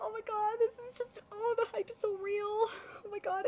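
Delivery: very high-pitched fangirl squeal; real excited squeal. Real, not acted. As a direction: squeal with joy as the hype takes over. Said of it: "I'm so happy".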